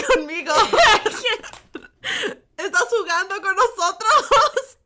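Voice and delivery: high pleading through giggles; laughing plea. Real, not acted. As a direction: beg mom while cracking up.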